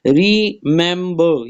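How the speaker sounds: Remember slowly as re, mem, ber; syllable by syllable. Shown as a demonstration. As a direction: break remember into three beats. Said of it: "ये भी three syllable है".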